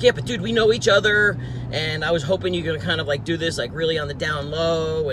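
wheedling lowball. Retold or imitated. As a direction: coax a discount like an old friend. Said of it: "all this kind of low ball talk".

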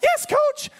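high, loud reply to coach; eager shout. Retold or imitated. Young player answering his coach, eager shout.

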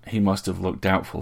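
usual speaking voice

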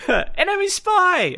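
put-on very high, drawn-out kid's cry; young kid. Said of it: "the, the young kid".

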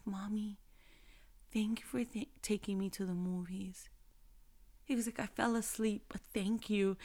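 soft, hushed, tender retelling; almost whispered, gentle and grateful; soft hushed thanks. Retold or imitated. Mom softly retelling her son's thank you, hushed. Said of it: "hushed retelling of son's thanks".

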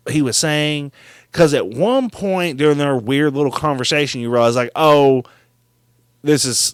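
usual speaking voice

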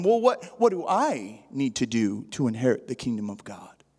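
acting out a testing, goading question; taunting. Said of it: "he was taunting him".

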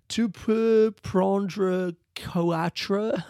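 very slow, high, exaggerated French mockery; mocking French. Chicken shop guy teasing a tourist, slow mock French. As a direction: drag out the French like talking to a child. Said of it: "he starts making fun of my accent".

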